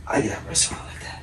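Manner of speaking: breathy, secretive whisper. Real, not acted. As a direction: let it slip out as a hushed wish. Joking confession aside.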